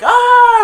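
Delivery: loud, high-pitched scream; shrieked movie scream; imitated scream. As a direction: mimic a frightened kid screaming.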